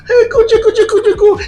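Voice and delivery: very high, sing-song coochie-coo; doting adult cooing at an infant; baby talk cooing. Acted.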